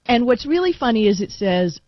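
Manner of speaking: normal talking voice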